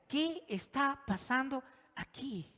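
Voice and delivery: slow, high, carefully spaced question; very cautious. Shown as a demonstration. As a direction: ask it gingerly, word by word. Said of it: "vamos a contestar la pregunta con mucha".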